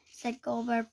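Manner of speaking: ordinary delivery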